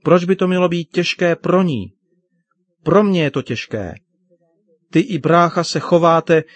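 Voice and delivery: high-pitched hurt complaint; indignant outburst. Acted. Upset daughter argues with her father.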